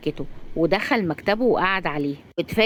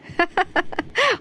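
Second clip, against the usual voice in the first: high, short giggle; light, high-pitched laugh; amused laugh; 11 semitones higher than usual. Acted. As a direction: giggle at a child's protest. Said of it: "صفاء بضحكة".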